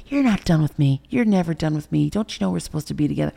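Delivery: mock loud, possessive retort; insistent boyfriend. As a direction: lightly imitate a boyfriend refusing to let go.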